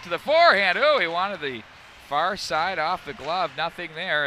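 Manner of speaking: loud, building breakaway call; announcer calling a breakaway, loud and excited. Real, not acted. Breakaway out of penalty box.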